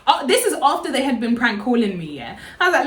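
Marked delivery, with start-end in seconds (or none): none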